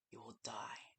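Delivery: hushed, urgent warning whisper; ominous whisper. Acted. Guide warns the player away from the room, whispering. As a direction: breathe the warning like a secret.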